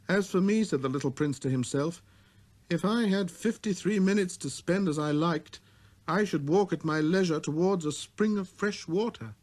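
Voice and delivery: put-on high, light boyish voice; a small boy's clear greeting; little prince voice.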